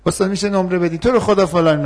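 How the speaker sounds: high pleading student voice, begging for a grade. Acted. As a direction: beg the professor like a whiny student. Student begging role-play. (دانشجوی ملتمس با صدای زیر)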